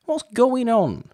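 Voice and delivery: real baffled outcry; incredulous squeak. Real, not acted. Incredulous at animals in beds.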